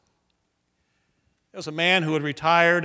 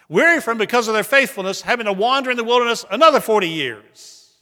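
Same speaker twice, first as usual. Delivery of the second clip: rising sermon cadence, hitting each weary; preaching at full force; 4 semitones higher, 6 dB louder than usual. Acted. Preacher driving home Israelites' weariness to congregation, full-force preaching. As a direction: build the list like a preacher on fire.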